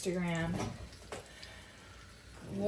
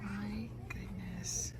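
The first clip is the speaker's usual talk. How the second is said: whispered; 9 dB quieter, much slower than usual. Real, not acted. Whispered in store.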